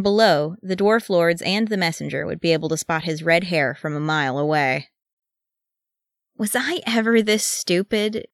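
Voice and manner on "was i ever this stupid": higher-pitched despairing question; exasperated, rhetorical wail, understated; exasperated demand. A narrator's reading. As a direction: ask the air in exasperation. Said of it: "Keeley demanded of the air".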